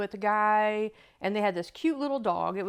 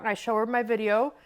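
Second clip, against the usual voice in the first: normal talking voice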